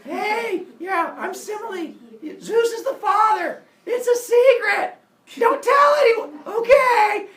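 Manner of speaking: very high, loud hammed-up yelling; shouting to everyone. Semele tells everyone her secret, high mock shouting. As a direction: broadcast the secret at the top of your voice. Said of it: "she just tells everyone".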